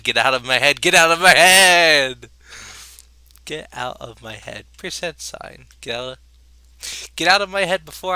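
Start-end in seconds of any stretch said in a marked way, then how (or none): 0.0-2.3 slow, drawn-out scream of the line; screamed climax. Acted. Reading the poem aloud, he screams the last repeat. 3.4-4.6 softer, slower, drained; quiet after scream. Acted. Reading the poem aloud, the line drops to a drained hush.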